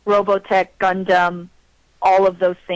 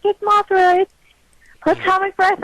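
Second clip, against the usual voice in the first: excited screaming; 13 semitones higher than usual. Retold or imitated. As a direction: squeal it like a thrilled fan. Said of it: "I was screaming like".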